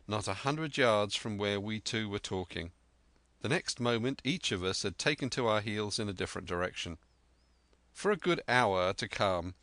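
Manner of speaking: ordinary delivery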